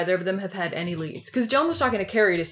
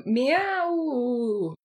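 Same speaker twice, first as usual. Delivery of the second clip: cat meow; 6 semitones higher than usual. Acted. Mock bobcat cry.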